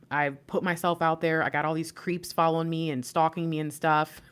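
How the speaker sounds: ordinary delivery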